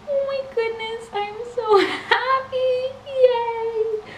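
high, teary voice near sobbing; happy crying. Real, not acted. Collector tearing up over her family pin, high and teary. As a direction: cry with joy. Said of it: "my roller coaster of emotions".

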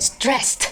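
"stressed" ending in a t sound. Shown as a demonstration. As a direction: say "stressed" with a final t, not ed. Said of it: "ออกเสียงเป็น t".

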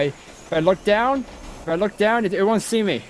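pleading that it won't see; scared pleading. Real, not acted. Hiding from monster.

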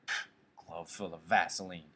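low sneer with a scoffing puff; understated disgust, pitch dropped. A narrator's reading. Candy joins the attack on Curly, disgusted. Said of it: "he said disgustedly".

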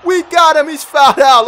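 excited shout. Real, not acted. Gamer celebrating the opponent fouling out, excited shout. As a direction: cheer as the foul-out lands.

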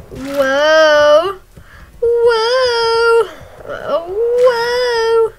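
high, loud drawn-out whoa; repeated loud excited whoa; amazed whoas. Real, not acted. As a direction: gasp in delight at the crash. Watching a wild crash.

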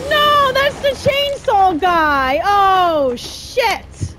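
high, loud, drawn-out cry of dismay; slow, loud panicked wail; alarmed yell. Real, not acted. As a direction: wail in panic at the sudden threat. Chainsaw enemy appears in game.

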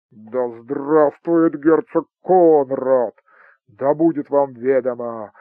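loud, slow jubilant cry; triumphant shout. A narrator's reading. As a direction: bellow it out in triumph. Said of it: "и крикнул".

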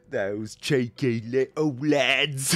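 put-on slow, raspy London accent; ropey London accent. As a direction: drag it out in a silly, raspy Cockney-style London voice. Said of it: "in a London accent".

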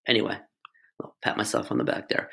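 soft breathy whisper to herself; whispered aside. Real, not acted. Teacher whispering a self-congratulating aside to the class. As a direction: whisper it like a private pat on the back.

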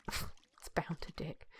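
whispered, slow and very soft; amused whisper. Real, not acted. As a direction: repeat it under your breath, savouring the joke. Joke repeated under the breath.

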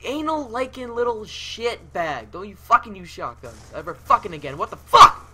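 high, loud real rage; furious outburst. Real, not acted. Gamer killed by a shotgun user, furious yelling. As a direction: rant at the opponent, peaking in a shout.

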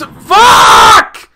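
long, very loud curse; furious shout. Real, not acted. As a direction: let the frustration explode. Fails in the game.

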